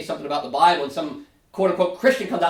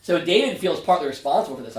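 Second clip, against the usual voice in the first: ordinary delivery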